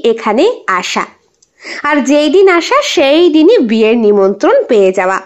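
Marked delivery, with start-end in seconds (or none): none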